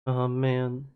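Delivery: ordinary delivery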